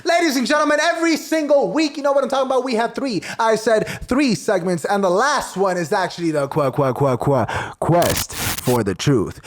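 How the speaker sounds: high-pitched emcee introducing a segment; show announcer. Acted. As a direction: hype up the segment like a ringmaster, drawing out the title.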